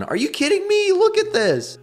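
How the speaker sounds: high-pitched, exasperated disbelief; incredulous outburst. Real, not acted. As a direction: squeal it out, appalled at the game. Frustrated reaction to game level.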